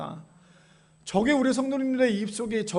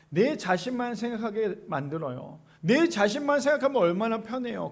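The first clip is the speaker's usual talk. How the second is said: usual speaking voice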